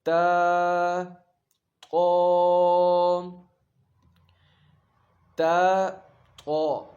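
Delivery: loud, clear تا against قا; contrasting letters. Shown as a demonstration. Teacher drilling تا and قا, loud contrast. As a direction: call out تا then قا distinctly. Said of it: "تو ہم ان کو پڑھتے ہیں ابھی".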